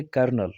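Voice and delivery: "कर्नल" as kur-nul, two syllables; correct pronunciation. Shown as a demonstration. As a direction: silent first L in "कर्नल", said as r. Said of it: "पहले French में पढ़ा". ("कर्नल", दो हिस्सों में सही उच्चारण)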